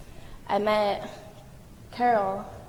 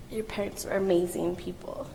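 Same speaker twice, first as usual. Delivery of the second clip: ordinary talk, nothing marked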